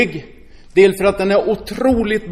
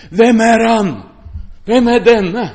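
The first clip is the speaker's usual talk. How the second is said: loud, alarmed cry of the frightened disciples; fearful cry; 4 semitones higher, 5 dB louder, slower than usual. Acted. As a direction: call it out in rising terror.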